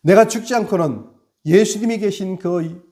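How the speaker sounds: loud, high fervent sermon; impassioned Easter sermon climax; preaching at full force. Acted. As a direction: drive the message home like a revival preacher.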